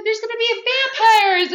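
very high, loud mock shriek; frantic mock alarm. Girl warning the school of vampires, frantic mock alarm. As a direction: cry it out in hammed-up panic.

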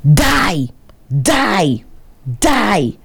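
separate, falling cries, imitating her yell; mock yell, not at full volume; retold yelling. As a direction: re-enact her yelling, each word its own burst. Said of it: "started yelling at the man".

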